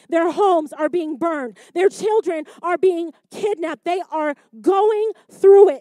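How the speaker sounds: preaching, building from firm to loud. Acted. Preacher to congregation, building from firm to loud. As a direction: climb from firm to a loud peak.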